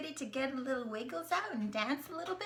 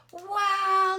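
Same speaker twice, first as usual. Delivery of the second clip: excited wow; 7 semitones higher, 10 dB louder than usual. Acted. Excited at kayak picture.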